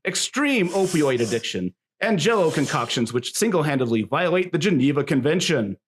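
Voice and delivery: hammed-up ringside patter; showy fight-night emcee delivery; wrestling announcer. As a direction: work the crowd up to the main bout. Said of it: "my best wrestling announcer voice".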